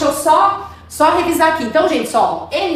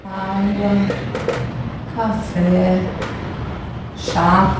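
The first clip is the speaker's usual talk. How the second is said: low, drawn-out single words; slow writing-along murmur; 5 semitones lower, 5 dB quieter, much slower than usual. Real, not acted. Teacher fills in the drinks chart, slow low words. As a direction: say each word slowly as you write it.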